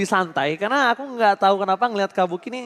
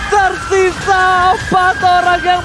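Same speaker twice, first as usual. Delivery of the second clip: fighting game announcer; 8 semitones higher, 7 dB louder than usual. Acted. Fighting game announcer-style calls.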